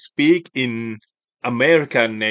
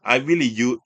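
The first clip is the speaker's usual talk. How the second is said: usual speaking voice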